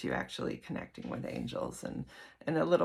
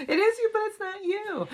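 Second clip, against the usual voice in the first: laughing; 6 dB louder than usual. Real, not acted. Laughs through the line.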